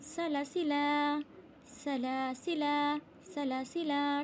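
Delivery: "सलासिला" slowly chanted, short second syllable; unstretched alif. Shown as a demonstration. Teacher showing students, "सलासिला" chanted without stretching lam. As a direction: recite "सलासिला" evenly, second la not drawn out. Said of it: "लाम के ये अलिफ को नहीं खींचना".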